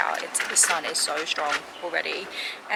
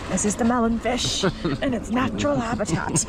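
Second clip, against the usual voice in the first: nature documentary; 4 semitones higher than usual. Pretend. Mock nature documentary narration.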